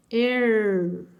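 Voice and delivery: "R" read as a drawn-out "errr", tongue curled; caipira R. Shown as a demonstration. Teacher reading E plus R, caipira R held long. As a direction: hold the retroflex R after an E. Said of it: "vai fazer o som caipira".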